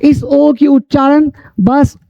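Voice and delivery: usual speaking voice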